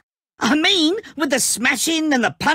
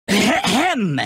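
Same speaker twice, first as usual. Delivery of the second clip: throat clear; 3 semitones lower than usual. Acted. Villain clears throat before proclamation.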